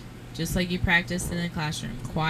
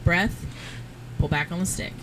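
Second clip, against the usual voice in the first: ordinary talk, nothing marked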